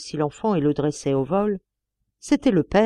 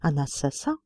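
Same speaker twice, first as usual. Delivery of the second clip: stammering in shock; 4 semitones lower, 5 dB quieter than usual. A narrator's reading. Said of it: "balbutia Toinon".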